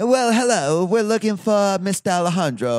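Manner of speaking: high-pitched mock British lady; butchered British accent. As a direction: put on an exaggerated British lady's phone greeting. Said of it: "totally butcher the accent".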